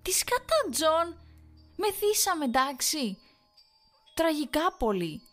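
very high, loud frantic shriek; hysterical screaming. A narrator's reading. Wife screaming at her husband about the night. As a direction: shriek it all out at him.

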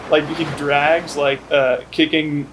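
ordinary talk

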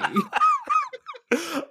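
bursting out in a high laugh. Real, not acted. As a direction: let out a big high laugh. Laughs at the other's reaction.

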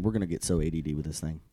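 ordinary talk

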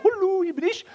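very high silly little voice; silly aquarium human. Pretend. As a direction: squeak like a tiny creature behind glass. (hohe, alberne Stimme)

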